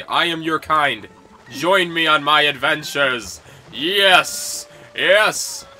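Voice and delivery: slow, loud, hammed-up proclamation; mock grand summoning.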